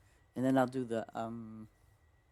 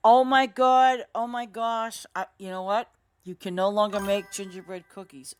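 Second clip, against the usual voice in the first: shocked dismay; 7 semitones higher, 11 dB louder than usual. Real, not acted. Shocked at lost recipe.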